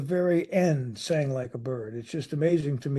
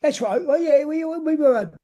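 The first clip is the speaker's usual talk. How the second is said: high, loud put-on voice of a fake British band; high, louder hammed-up fake British act; mock fake Brit; 11 semitones higher, 5 dB louder than usual. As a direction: ham up a phony bandmate playing along. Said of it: "it's like".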